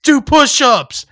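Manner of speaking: high, loud, slow drill order; imitated guard yell. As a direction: bark it like a mock drill sergeant. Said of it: "And they're like".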